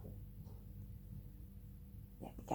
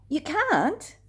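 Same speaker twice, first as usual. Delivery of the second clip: much higher, louder shocked protest; Peter protesting; 11 semitones higher, 7 dB louder than usual. Acted. Peter stopping Jesus from washing his feet, shocked. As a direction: stop the master in disbelief. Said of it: "Peter said".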